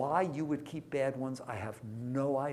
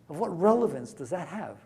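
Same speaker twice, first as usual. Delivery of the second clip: louder, pressing the question hard; yelling; 4 semitones higher, 6 dB louder than usual. Real, not acted. Teacher to the class, half-yelling the question. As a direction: demand the answer like a scolding. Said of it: "I'm yelling at you".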